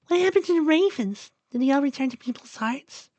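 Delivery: put-on high, girlish questioning voice; squeaky young character wondering aloud; Duck's curious voice. A narrator's reading. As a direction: ask it curiously, pitched far up.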